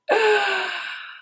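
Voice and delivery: a high, sudden burst of laughter at herself; embarrassed laugh. Real, not acted. Ranting vlogger laughs at how worked up she got, high burst of laughter. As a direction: laugh off the rant. Laughing at her own rant.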